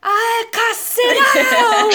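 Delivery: high, slow mock TV-host shout through laughter; laughing imitation of the host's cry; Gilberto Barros yell. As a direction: belt it like a hammed-up TV host. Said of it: "vídeo do Gilberto Barros".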